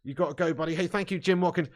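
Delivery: ordinary talk, nothing marked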